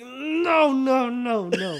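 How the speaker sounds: put-on high, loud whiny drunk no; drunk refusal. Drunk friend refusing to be carried.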